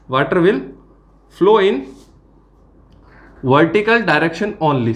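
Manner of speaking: slow dictation with long pauses between phrases; dictating. Real, not acted. Teacher dictating notes on water flow to students, slow dictation. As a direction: spell it out phrase by phrase for note-takers.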